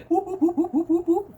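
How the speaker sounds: string of high, hooted whoops; playful whoops. Real, not acted. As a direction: hoot high in an even, repeated rhythm. Playful whoops before noodles.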